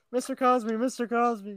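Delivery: reporters calling out. Retold or imitated. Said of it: "they're like".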